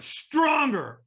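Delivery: high-pitched retold bellow; trainer's scream. Trainer pushing hesitant firewalker, screaming. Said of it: "he screamed at me again".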